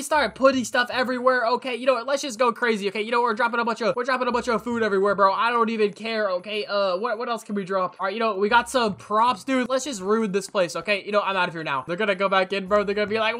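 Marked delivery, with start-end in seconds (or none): none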